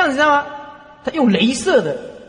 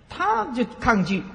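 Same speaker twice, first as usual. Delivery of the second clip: slowed and pitched higher, 她就抗拒 drawn out; slow emphatic; 6 semitones higher, slower than usual. Shown as a demonstration. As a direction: lean on 她就抗拒 slowly. Slow emphatic first take.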